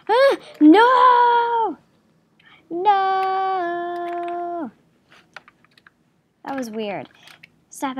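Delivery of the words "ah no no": long, high, loud wail of no; drawn-out high shout of dismay; panicked cry. Real, not acted. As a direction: cry out in alarm, holding the vowel. Panics during the game.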